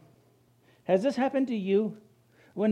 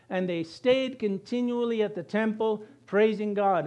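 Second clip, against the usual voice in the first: normal talking voice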